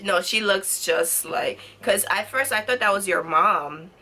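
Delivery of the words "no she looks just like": normal talking voice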